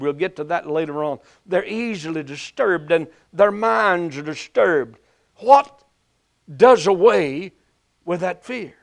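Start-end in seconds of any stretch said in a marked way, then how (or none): 5.4-8.7 slow, rising preacher's cadence; preaching. Acted. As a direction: preach it with fervor. Preaching climax.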